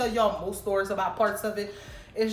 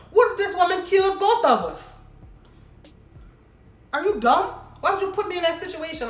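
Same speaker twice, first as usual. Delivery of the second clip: louder and higher, incredulous fury; outraged disbelief; 9 semitones higher, 6 dB louder than usual. Real, not acted. Telling listeners about the cheating boyfriend, outraged. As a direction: let the outrage burst out at him.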